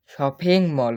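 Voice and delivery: Shopping মল called out loud and clear; teacher projecting the word for students; loud model word. Shown as a demonstration. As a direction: announce shopping মল loudly to the class. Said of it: "এভাবে উচ্চারণ করে পড়ব".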